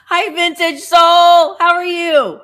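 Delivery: loud, high, drawn-out hello; calling out greeting. Real, not acted. Host greeting a viewer who joins, calling out. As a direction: sing out an excited welcome.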